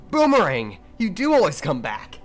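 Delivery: loud, high, eager call to the boomerang; a lightly voiced Sokka, shouting it out; Sokka impression. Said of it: "can you do Sokka".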